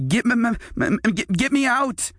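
put-on trapped boy hollering from the stump. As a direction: scream to be freed. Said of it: "Nate hollered".

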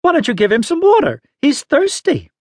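put-on high, quick old-woman fussing; scolding old lady. A narrator's reading. Exasperated old lady scolds boy.

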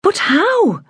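put-on young girl, anxious yet puzzled. A narrator's reading. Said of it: "anxious yet puzzled".

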